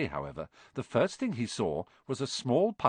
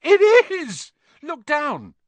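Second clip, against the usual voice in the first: very high, loud yell of amazement; excited yell; 11 dB louder, slower than usual. A narrator's reading. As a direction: yell it out in astonishment. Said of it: "Ron gave a yell".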